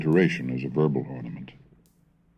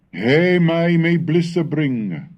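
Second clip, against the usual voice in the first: "He may me blisse bring" recited higher, louder and slow, "He" stressed; recited line; 11 semitones higher, 6 dB louder, slower than usual. Shown as a demonstration. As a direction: lift "He" and draw the line out lyrically. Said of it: "so that in line seven".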